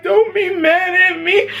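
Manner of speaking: high, slow whiny plea; mock heartbroken pet owner; mushy pleading. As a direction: plead pitifully in a high, drawn-out voice. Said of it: "the mushy gush bitch in me".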